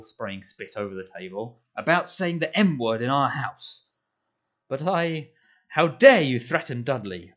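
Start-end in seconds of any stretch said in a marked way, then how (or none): 1.8-3.8 loud, much higher thundering. A narrator's reading. Uncle thundering at nephew over the M word, loud. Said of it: "thundered his uncle". 4.7-5.3 higher, cut off. A narrator's reading. Boy cut off by uncle. 5.7-7.3 very loud furious roar. A narrator's reading. Uncle roaring at nephew while pounding the table, very loud. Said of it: "roared Uncle Vernon".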